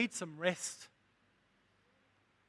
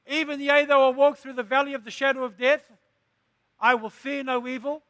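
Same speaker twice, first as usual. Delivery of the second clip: higher and louder, a soaring declaration; full-force preaching; 4 semitones higher, 7 dB louder than usual. Acted. Preacher proclaims the psalm to his church at full force. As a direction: lift the verse up and thunder it out.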